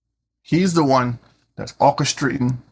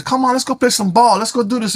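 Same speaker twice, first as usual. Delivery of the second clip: higher, lightly voiced eager friend calling you to play; 8 semitones higher than usual. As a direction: pitch it up like a buddy shouting come on. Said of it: "and your friend says".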